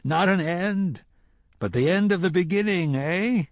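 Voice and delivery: sly villain relishing the moment. A narrator's reading. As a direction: savour each word like a private joke.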